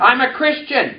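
calling out the line for echo; preacher's call line; congregation repeat-after-me. Shown as a demonstration.

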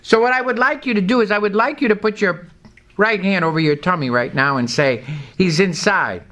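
high, fervent preaching, line by line; call-and-response declaration. Acted. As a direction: declare it so the church repeats after you. Leads congregation in call-and-response.

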